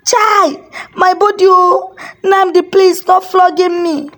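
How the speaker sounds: high wailing plea under the lash; crying and wailing. A narrator's reading. Chukwunano wailing to his brother's ghost, high crying plea. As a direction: cry out high, begging for mercy. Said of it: "He, he was crying".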